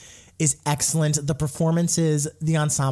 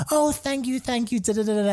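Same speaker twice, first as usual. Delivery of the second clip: imitating the kid's thanks; 9 semitones higher than usual. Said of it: "he starts off with".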